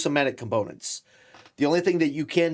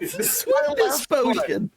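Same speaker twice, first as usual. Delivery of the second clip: said through laughter; high, giggling through the words; laughing. Real, not acted. Table cracking up over potion.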